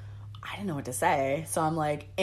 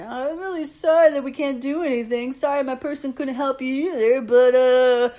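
high, put-on apologetic manager voice; hammed-up apology, climbing louder at the end; mock re-apologizing; 7 semitones higher, 6 dB louder than usual. Manager re-apologizes to a complaining customer, mocking high voice. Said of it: "uh, re-apologizing".

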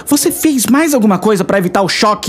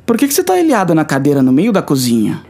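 usual speaking voice

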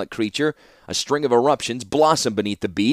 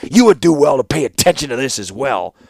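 loud, higher, forceful admonishment; shouted warning; 4 semitones higher, 7 dB louder than usual. A narrator's reading. Warlord warning the artificer to heed the lesson, shouting. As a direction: warn him sharply over the noise. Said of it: "the warlord shouted in return".

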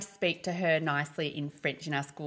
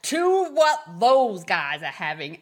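put-on loud, slow, brash American accent; imitating a loud American tourist ordering in a big American accent; 10 dB louder, slower than usual. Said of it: "in his big American accent".

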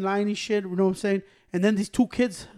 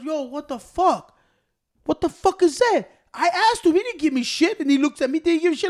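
high, louder indignant protest; imitated angry complaint; 7 semitones higher, 4 dB louder than usual. Man in line protesting he got nothing, imitated high indignation. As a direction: act out an aggrieved customer.

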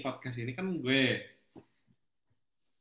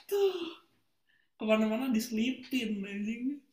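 high-pitched excited teasing; excited squeal; 9 semitones higher than usual. Real, not acted. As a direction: squeal it out in delight. Teasing friend who plugs podcast.

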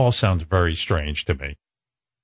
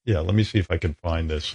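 ordinary talk, nothing marked

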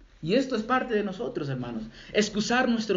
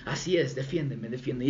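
low, soft, scheming whisper-like voice of anger; sly voice of anger; 6 semitones lower, 4 dB quieter than usual. Retold or imitated. La ira habla detrás del creyente, baja y astuta. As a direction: lurk behind and urge, low and soft. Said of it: "la ira está allá detrás de ti".

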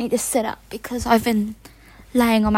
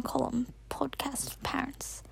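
a much softer whispered announcement; 10 dB quieter than usual. Real, not acted. Naming her parent episodes to listeners, soft whisper. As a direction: breathe the title out as a secret.

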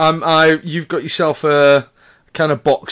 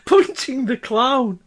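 high, slow, helpless with laughter; barely able to say it for laughing; cracking up; 12 semitones higher, much slower than usual. Real, not acted. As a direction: squeeze the words out through laughter. Cracking up at the euphemism.

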